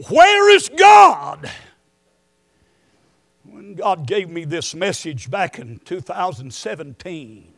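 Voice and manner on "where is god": high, loud, slow question; slow shout. Real, not acted. Preacher posing the big question to the church, slow shout. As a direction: draw the question out at full voice.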